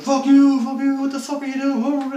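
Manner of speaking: high, loud protest at captors; acted furious yelling. Kidnap victim to his captors as trunk opens, acted yelling. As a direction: yell it as a struggling captive, acted out.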